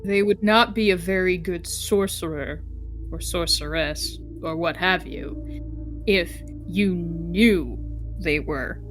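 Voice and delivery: ordinary talk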